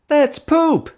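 shrill high loud scream. A narrator's reading. Hank screaming at Mom as the chimp throws poop. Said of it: "he screamed".

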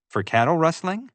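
high, rising hopeful question. A narrator's reading. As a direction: ask it hoping for a yes. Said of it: "I asked hopefully".

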